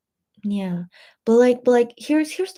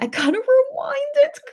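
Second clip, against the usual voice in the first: laughing. Real, not acted. Laughs while teasing viewer.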